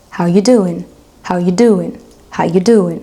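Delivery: HOW YOU DOING run together, "are" dropped; "How you doing" in one fluid casual flow; linked short form. Shown as a demonstration. Teacher repeating the shorter American option for learners, linked short form. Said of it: "il faut pouvoir vraiment lier les mots".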